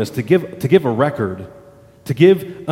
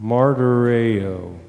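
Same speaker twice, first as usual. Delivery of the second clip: Martyreo drawn out at half speed, lower and evenly; slow; 6 semitones lower, 4 dB quieter, much slower than usual. Shown as a demonstration. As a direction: stretch out every syllable of Martyreo. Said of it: "Say that word slowly".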